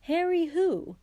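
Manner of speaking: baffled husband, pitch swooping up then falling; blank puzzlement. A narrator's reading. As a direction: ask in innocent confusion. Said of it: "said Mister Weasley blankly".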